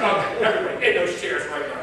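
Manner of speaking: put-on father ordering kids to the table; stern order. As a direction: bark the family to the table. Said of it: "I said".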